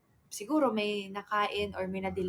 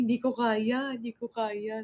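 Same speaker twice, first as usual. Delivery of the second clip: imitating sister crying. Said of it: "Sabi niya".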